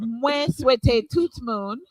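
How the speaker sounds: slow Creole with a foreign missionary accent; careful missionary Creole. Shown as a demonstration. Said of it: "can you give us a small demonstration".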